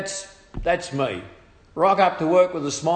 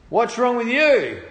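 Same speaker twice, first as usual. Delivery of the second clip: loud, high retold question; raised voice, acting out alarm; imitated startled workmates; 9 semitones higher, 6 dB louder than usual. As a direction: call out like baffled workmates. Imitating workmates reacting to his groans.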